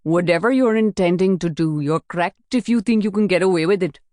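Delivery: defiant threat; defiant warning. Acted. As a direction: warn them they won't get away with it. Wasan defiant in the car.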